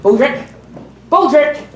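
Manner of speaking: loud summoning call. Acted. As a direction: call him from across the house. Calling out for Baldrick.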